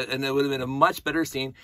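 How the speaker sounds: normal talking voice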